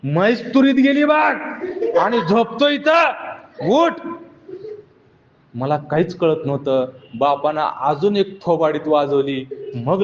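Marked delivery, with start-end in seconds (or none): 0.0-3.9 father shouting. Retold or imitated. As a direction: yell at the lazy son to wake up. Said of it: "बाप ओरडत होता". (बापाचा मोठा ओरडा)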